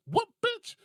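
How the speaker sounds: very high, loud retort; mock outraged comeback. Guy answers a challenge over a shoe, mock outraged. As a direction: squeal back in comic outrage.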